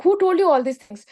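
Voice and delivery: mock alarm at being found out; startled demand. Businessman confronting his wife, mildly startled. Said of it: "businessman will be like".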